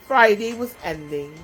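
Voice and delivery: usual speaking voice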